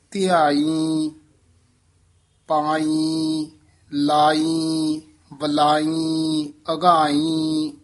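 very slow, each word drawn out with the nasal ਬਿੰਦੀ sound on the final ਈ, as in ਧਿਆਈ and ਪਾਈ; careful nasal demo. Shown as a demonstration. Teacher showing students where the bindi nasal goes, very slow and deliberate. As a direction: stretch every word slowly so the nasal ਈ in ਲਾਈ is heard. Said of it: "ਇਉਂ ਮਹਾਰਾਜ ਨੇ ਬਿੰਦੀਆਂ ਲਾਈਆਂ ਹੋਈਆਂ ਨੇ".

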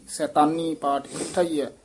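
ordinary talk

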